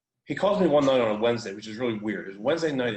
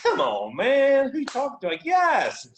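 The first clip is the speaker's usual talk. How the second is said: much higher, louder burst of agreement; eager yes; 13 semitones higher, 6 dB louder, slower than usual. Retold or imitated. Client shouting an eager yes to his coach. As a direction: leap at the offer.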